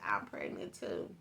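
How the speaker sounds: hushed "I'm pregnant too"; whispered confession. Retold or imitated. Other girl's news, retold.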